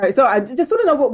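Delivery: normal talking voice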